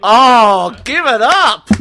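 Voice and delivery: loud cheering shout. Real, not acted. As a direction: cheer him on loudly. Cheering on the drinker.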